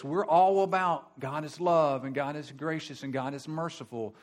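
ordinary talk

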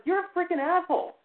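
high, loud and outraged; furious retold yell. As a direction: yell it up high at the one tormenting you. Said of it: "And I'm just like".

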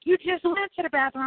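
high mock grandmother scolding. Grandmother scolding the nervous child, high mock voice. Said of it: "my grandmother's like".

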